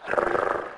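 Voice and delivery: R as a loose, sustained "rrr"; rolled letter sound. Shown as a demonstration. Teacher repeats the R sound for learners, rolled long. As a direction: trill R alone, no vowel. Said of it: "R is pronounced as R".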